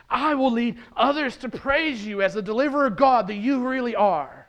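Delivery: high, loud proclamation of praise; joyful shout. Acted. Risen Jesus promising to lead others in praise, joyful shout. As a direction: declare it jubilantly at full voice. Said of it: "as he joyfully shouts".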